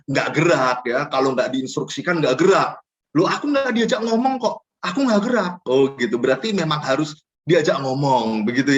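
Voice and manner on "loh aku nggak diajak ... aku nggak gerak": higher whiny excuse voice; complaining member. Retold or imitated. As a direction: pout like an unbothered member who waits to be told. (anggota jemaat mengeluh dengan suara lebih tinggi)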